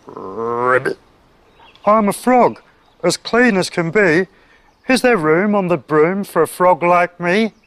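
croaking frog. A narrator's reading. Frog asks witch for room on broom, croakily. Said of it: "then said with a croak".

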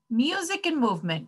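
quick, high announcement; teacher calling out. A narrator's reading. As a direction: call the class to the next activity. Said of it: "called the teacher".

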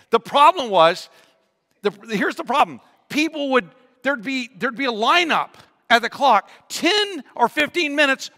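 high, loud, animated pulpit voice; full-force preaching storytelling. Real, not acted. Preacher warning about lining up at the clock, loud and animated. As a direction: belt the story out to the room.

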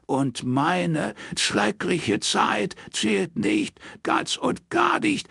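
very high, slow, dragged-out anguished shout. Acted. Father shouting back at his son-in-law, high and slow. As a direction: howl out the old grievance. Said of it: "schrie Roy".

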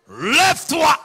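high, booming command; loud voice. Retold or imitated. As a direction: shout it as an order to rise. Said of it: "dit d'une voix forte". (kòmande ak vwa fò)

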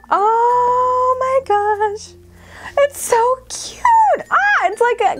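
high, drawn-out gushing; squealing delight. Real, not acted. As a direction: stretch it into an excited squeal. Gushing over cute mugs.

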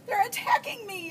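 high-pitched alarmed boy's yell, acted. A narrator's reading. Junior among flapping chickens, yelling in high-pitched alarm. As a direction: yell it up high as a startled boy. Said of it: "yelled Junior".

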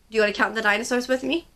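ordinary delivery

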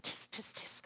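breathless tut-tut clicks of disapproval; unvoiced scolding tisk tisk; disapproving tisks. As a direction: tut at her like a judging churchgoer. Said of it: "people saying".

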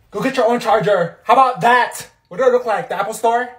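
loud angry yelling. Acted. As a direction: yell it at her, furious. Charger argument, yelling.

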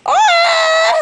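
panicked screaming. Acted. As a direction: shriek in mock terror. Acted panic, screaming for help.

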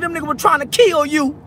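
usual speaking voice

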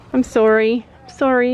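ordinary delivery

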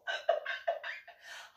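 high breathy giggle; real laughter. Real, not acted. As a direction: burst into a giggle. Laughing at the memory.

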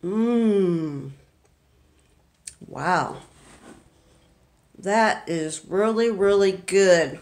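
slow, relishing mmm over a tasty bite; savoring delicious food. Real, not acted. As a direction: savor every word like the food is amazing. Tasting the brownie on camera.

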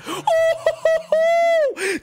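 high, loud drawn-out squeal of joy; excited whoop. Real, not acted. Big pull on livestream.

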